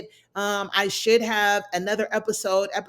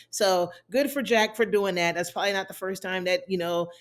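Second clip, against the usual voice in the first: usual speaking voice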